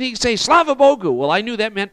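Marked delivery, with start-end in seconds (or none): 0.4-1.1 retold exclamation. Man praising God loudly.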